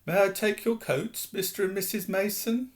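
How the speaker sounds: sweetly polite, trying hard; polite boy voice. A narrator's reading. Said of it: "Dudley's voice".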